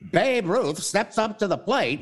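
put-on a slow old-time Mid-Atlantic radio call; old announcer accent. Radio host mimicking a forties announcer in Mid-Atlantic accent. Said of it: "it's called the mid or transatlantic accent".

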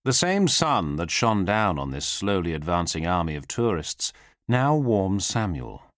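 usual speaking voice

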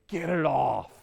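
mildly booming man's demand. Retold or imitated. Homeless man to a passerby behind him, imitated booming voice. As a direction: imitate a big man calling out, moderately loud. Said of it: "I hear the booming voice".

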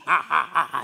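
mock laugh, deliberately spaced out; acted laugh. Man bitten by a zombie mocking cannibals, acted laugh. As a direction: imitate a victim's taunting laugh. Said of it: "He starts laughing".

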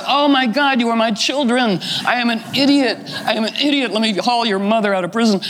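high-pitched, mock-dramatic outburst of self-reproach; hammed-up, high-voiced astonishment; astonished realization. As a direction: exclaim as a sultan suddenly struck by the truth. Sultan's shocked realization, acted.

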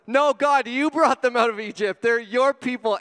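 loud, high pleading protest; Moses protesting loudly. Retold or imitated. Pastor as Moses loudly protesting to God. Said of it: "And Moses is like".

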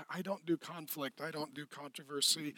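very soft, low, meek voice; hammed-up nervous meekness; timid lamb person. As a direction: shrink back quietly, avoiding any conflict. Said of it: "What happens if you're only a lamb?".

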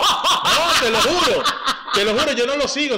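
laughing denial. Real, not acted. Host denying he follows the singer, laughing. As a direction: swear it while cracking up. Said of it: "laughing denial".

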